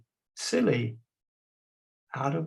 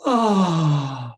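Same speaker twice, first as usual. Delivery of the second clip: loud open-throated sighing oh; loud sad sigh; big sigh; 7 dB louder than usual. Shown as a demonstration. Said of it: "big sigh, everybody".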